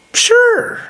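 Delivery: very high-pitched assent; mock agreeable. Man accepting woman's drinks deal, high mock agreement. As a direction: chirp it in a high put-on voice.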